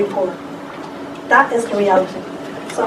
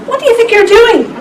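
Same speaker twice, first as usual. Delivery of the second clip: mild mock shout, pitched much higher; retold indignant shout; 11 semitones higher, 3 dB louder than usual. Speaker retells confronting the man with the baton, mild high shout. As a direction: call it out in high-pitched protest, not very loud. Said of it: "Even I shout".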